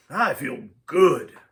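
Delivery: slow, groggy drawl; drowsy, contented and slow; waking fighter. A narrator's reading. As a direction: stretch it out like someone just waking. Baltek wakes from sleep.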